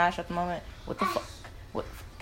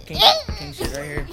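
hyped up; 5 dB louder than usual. Real, not acted. Hyped about his pick.

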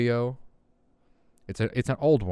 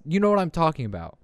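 ordinary delivery

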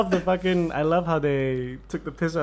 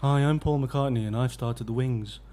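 a lower mock Liverpool voice, lightly done; 5 semitones lower, 4 dB quieter than usual. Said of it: "'Cause Paul was a bit lower".

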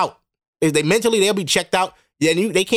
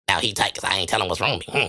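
mocking women; 11 semitones lower, 5 dB quieter than usual. Mocking how women act upset.

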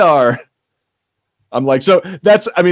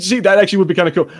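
usual speaking voice

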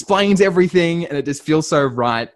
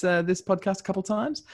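ordinary talk

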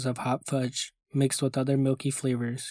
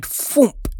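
slow, loud burst on thwomp; booming thwomp; 11 semitones higher, 9 dB louder than usual. Acted. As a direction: make the word explode like a firework. Ice cream man mimics bursting firework.

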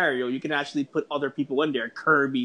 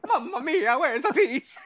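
Mario impression; 10 semitones higher than usual. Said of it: "I hear sound bites, bites of him".

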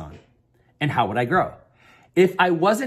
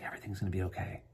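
murmured to self; 8 semitones lower, 16 dB quieter, faster than usual. Retold or imitated. Murmurs to himself walking away.

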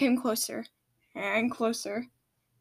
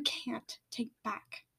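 whispered; 11 dB quieter, much slower than usual. Acted. Volcanic ends rebuke.

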